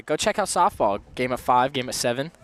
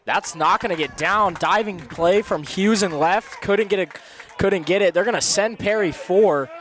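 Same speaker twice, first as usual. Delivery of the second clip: higher-pitched, animated live call; excited play-by-play; 5 semitones higher than usual. Real, not acted. Announcer calls diving play in left, excited and higher.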